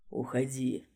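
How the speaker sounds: strained low warning; strained, tense low voice. Acted. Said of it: "напряжённый голос".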